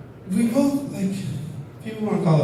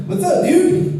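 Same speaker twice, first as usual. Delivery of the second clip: mock surfer greeting; slower than usual. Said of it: "I just have to be like".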